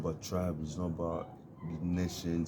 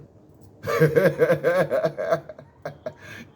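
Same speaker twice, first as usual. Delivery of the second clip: high, loud burst of laughter; 9 semitones higher, 11 dB louder than usual. Real, not acted. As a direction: crack up at your own line. Laughs after own joke.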